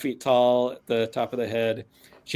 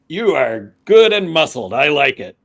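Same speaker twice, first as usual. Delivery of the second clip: loud, higher-pitched approving orc; orc speaking Orcish; 4 semitones higher, 8 dB louder than usual. Acted. Orc owner speaks Orc to Reyna, boldly approving. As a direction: admire a fellow orc with gusto. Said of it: "switches to, to Orc and says".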